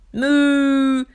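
loud moo; chasing cow moo. A narrator's reading. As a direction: moo as you chase him off. Cow chases farmer.

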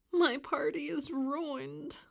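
high, drawn-out mild moan. A narrator's reading. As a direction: lightly whine it, stretching the words. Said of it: "moaned D W".

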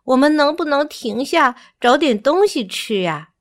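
high, pleading little monkey voice; complaining. A narrator's reading. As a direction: whine for a break and a snack. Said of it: "奥东奥抱怨着".

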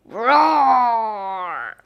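drawn-out growling roar; dragon roar. Acted. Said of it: "The dragon roars".

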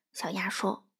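ordinary delivery, nothing marked

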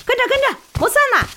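high calling shout of running porters. Acted. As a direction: sing out to clear the way.

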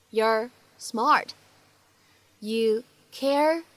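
slow reading with a pause after each word or two. Shown as a demonstration. Teacher reading the text out for learners, word by word. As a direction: break it into small separate chunks for learners.

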